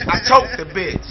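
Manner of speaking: normal talking voice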